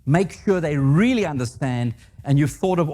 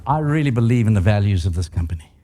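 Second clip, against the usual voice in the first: ordinary talk, nothing marked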